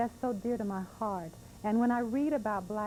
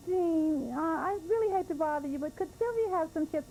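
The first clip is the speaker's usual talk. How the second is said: mother; 8 semitones higher than usual. Retold or imitated. Mother asks politely, voiced.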